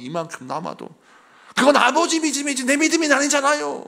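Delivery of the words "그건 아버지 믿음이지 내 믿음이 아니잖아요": higher, louder protesting son; son's protest. Retold or imitated. Son protests his father's faith, loud and high. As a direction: push back hard at your father.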